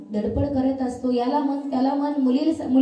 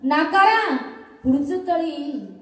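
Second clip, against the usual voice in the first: lightly acted exasperated mother, pitched up; mother objecting; 5 semitones higher than usual. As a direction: push back at the husband in a higher voice. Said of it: "पण आई सांगते".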